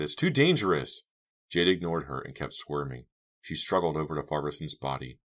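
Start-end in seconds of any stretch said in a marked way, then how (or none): 0.0-1.0 high, urgent warning; alarmed cry. A narrator's reading. Girl warning friend of danger, crying out. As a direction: cry out with alarm, pitch raised. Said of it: "Dina cried".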